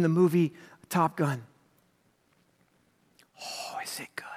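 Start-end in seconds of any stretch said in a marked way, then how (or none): 3.4-4.3 whispered aside. Real, not acted. Aside to audience.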